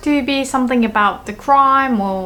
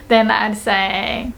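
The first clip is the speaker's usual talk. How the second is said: ordinary talk, nothing marked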